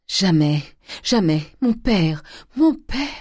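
loud, high wailing outcry; anguished grief. Acted. Nephew refusing consolation for his father, wailing. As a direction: cry out in despair, rising to a wail. Fils en larmes crie son deuil.

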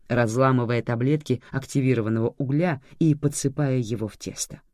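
ordinary talk

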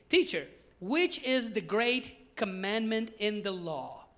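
loud, emphatic preacher's Bible reading; scripture reading. Real, not acted. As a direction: proclaim the passage with measured force. Reads scripture aloud. (lectura bíblica solemne y enérgica)

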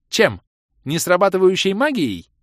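loud, high scornful question; contemptuous snort. A narrator's reading. Kirea mocks the mage's claim, snorting contemptuously. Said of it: "презрительно фыркнула Кирея".

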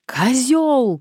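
high, loud furious cry; voice breaking with anger. A narrator's reading. Woman yelling into the phone at the caller, enraged. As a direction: shout the insult, voice cracking with rage. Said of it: "срывающимся от злости голосом".

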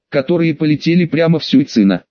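ordinary delivery, nothing marked

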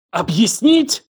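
high, incredulous, enraged question; choked with fury. A narrator's reading. Елена gasps at his excuse, furious. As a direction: throw the word back in outrage. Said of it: "Она задохнулась от ярости".

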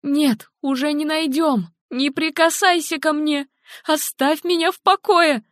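high, angry outcry pushing him away; shouting. A narrator's reading. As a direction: yell at him to leave her alone. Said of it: "закричала Хелен".

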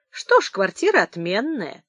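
ordinary delivery, nothing marked

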